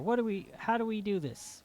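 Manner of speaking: normal talking voice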